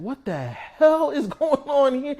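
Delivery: high, slow, loud amazed cry with a laugh; stunned disbelief. Retold or imitated. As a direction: act floored by what you just saw. Disciples' stunned reaction voiced.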